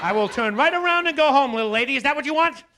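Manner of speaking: loud, high mock-stern parent threat; parental scolding. Son scolding his kicking mother like a child, mock-stern shout. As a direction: bark it like a fed-up dad in the car.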